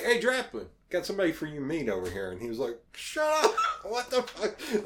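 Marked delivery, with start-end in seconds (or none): 0.0-2.3 retold call across the room, high and loud; high, raised-voice reenacted shout-out. Retold calling Draplin over to meet someone. 2.9-4.8 retold high, loud shriek of disbelief; mock starstruck freak-out, very high. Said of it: "he was freaking out".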